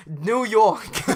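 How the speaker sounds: loud, high New York outburst; New York accent. Acted. Attendant revealing where he's from, loud New York accent.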